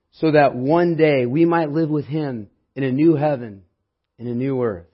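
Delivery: preaching. Acted. Preaching builds to climax.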